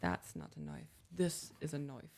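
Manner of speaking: attempted Aussie accent, softly spoken; quiet, tentative Australian accent. Shown as a demonstration. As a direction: try an Australian movie line, "knife" as "noif". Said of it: "Can you do an Australian accent?".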